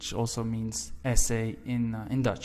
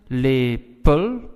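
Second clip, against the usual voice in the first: "lepel" slowly, weight on LE, unstressed -pel; unstressed last syllable; 6 dB louder than usual. Shown as a demonstration. Teacher demonstrating Dutch pronunciation to learners, last syllable of "lepel" unstressed. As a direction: say "lepel" with a light, unstressed "el" ending. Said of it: "L, unstressed".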